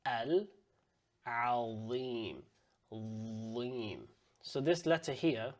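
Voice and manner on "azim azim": azim with a full, heavy dh at the start; Arabic emphatic azim. Shown as a demonstration. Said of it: "So here we're saying al, al, azim".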